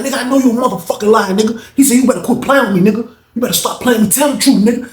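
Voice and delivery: high, fast menacing demand; voicing an inmate issuing a heated threat; threatening. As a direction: warn him to stop playing. Reggie threatening.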